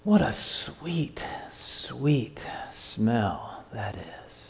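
acted blissful inhale, trailing into whisper; savouring a smell. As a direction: savour the aroma, voice dropping away to a hush. Acts out savouring a sweet aroma.

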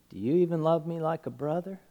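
very soft, quick question; hushed question. Retold or imitated. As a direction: ask it gently, barely voiced.

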